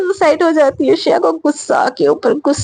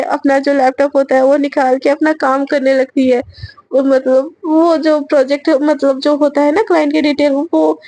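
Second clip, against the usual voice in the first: usual speaking voice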